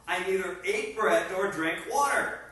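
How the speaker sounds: ordinary talk, nothing marked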